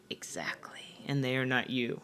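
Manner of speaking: hushed, voiceless, knowing answer; whispered reply. Retold or imitated. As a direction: murmur it under the breath like a gentle mentor. Coworker's calm reply, whispered.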